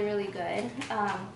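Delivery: ordinary talk, nothing marked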